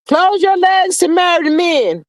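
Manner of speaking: mock Nene lecturing the ladies. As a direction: scold it like Nene would. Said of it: "in my Nene voice".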